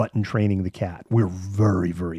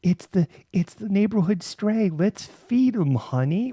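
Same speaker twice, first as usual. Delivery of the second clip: much higher, slow, doting neighbor voice; mock neighbor couple; 11 semitones higher, slower than usual. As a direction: coo it like a neighbor fooled by the cat. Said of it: "our cat has, like, the".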